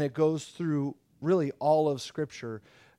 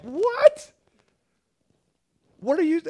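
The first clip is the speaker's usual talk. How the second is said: far higher, louder incredulous cry; shocked buddies; 13 semitones higher, 7 dB louder than usual. Retold or imitated. Buddies hear Jesus forgive sins, shocked outburst. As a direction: blurt it in disbelief.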